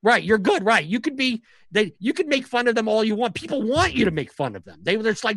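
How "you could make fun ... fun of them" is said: usual speaking voice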